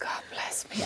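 breathless whisper. Real, not acted. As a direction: whisper it, embarrassed. Embarrassed by own diary.

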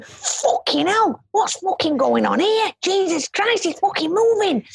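lightly voiced drunken panic; panicked drunk lads. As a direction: ham up the rowdy lads' alarm. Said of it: "and they're going".

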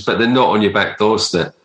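normal talking voice

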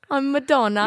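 laughing through a mock British accent; fake British accent, laughing. Cohost joins the bit laughing in a mock British accent. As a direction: giggle while doing a light fake British accent. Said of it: "my fake British accent".

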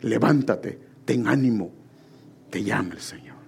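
low, softened relayed message; quiet, spaced-out words of encouragement; retold summons. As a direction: quote the servants in a hushed, low voice. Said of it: "Y le dijeron".

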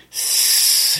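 long unvoiced hiss like a snake. Co-host voicing Gollum's warning, a drawn-out hiss. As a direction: hiss through the teeth. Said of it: "hissed Gollum".

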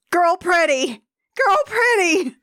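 high-pitched mock dumbstruck outburst; tongue-tied infatuation. Mocking a smitten student's thoughts, dumbstruck. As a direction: exclaim it like a besotted simpleton.

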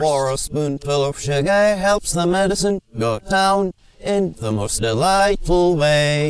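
reversed recording of backward singing; sung line flipped to forwards; played backwards. Shown as a demonstration. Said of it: "here's what he just did reverse".

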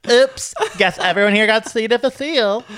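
high, loud and airy; mock innocent. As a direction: act falsely innocent. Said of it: "being like".

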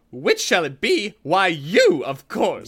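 a hint of a harsh old aunt; sharp auntie. A narrator's reading. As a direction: snap the answer down at the boy. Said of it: "said sharply".

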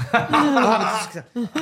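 high slow laughing plea. Acted. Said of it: "repeated plea".

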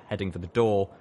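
usual speaking voice

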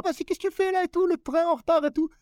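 high, mocking caricature of a young street girl. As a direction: squeal it like a teen from the projects. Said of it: "la petite Kaira".

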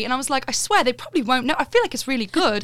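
showing off a put-on English accent. Shown as a demonstration. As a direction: keep up a British accent. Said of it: "I'll do it in this".

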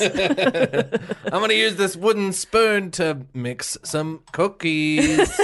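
slow, high, drawn-out fake menace; hammed-up menacing parent; mock threat. Mock threat turned harmless.

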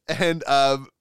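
ordinary delivery

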